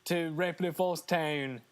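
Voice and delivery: slow, badly faked Australian; hammed-up Australian imitation; bad Australian accent. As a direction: drawl it in a clumsy Australian accent. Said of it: "that's my worst accent I've done".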